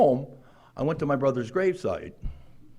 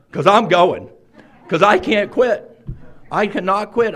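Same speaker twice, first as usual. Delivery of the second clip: higher, louder, slower cry of not being able to quit; desperate insistence; 5 semitones higher, 7 dB louder than usual. Retold or imitated. Remembering his drinking days, pleading that he can't stop. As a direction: push it out like a man who's trapped. Retold despair about quitting.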